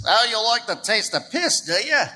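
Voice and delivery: imitated Australian accent; mocking question in an Australian accent; Australian bartender. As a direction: challenge like an Aussie barman. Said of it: "The bartender looks at me and says".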